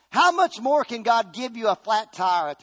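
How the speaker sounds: slow, loud, high preaching cadence; preaching at full force. Acted. Preacher to congregation, slow rising sermon cry. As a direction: hammer each question out to the church.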